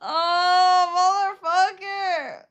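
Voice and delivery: high, loud indignant cry; playfully hammed-up fury; mock outrage. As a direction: exclaim it loudly, drawn out and indignant. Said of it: "I was so mad".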